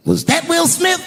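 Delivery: high, loud mock-innocent question; Chris Rock impression. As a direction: tease the crowd in a put-on voice. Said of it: "looks at the crowd and goes".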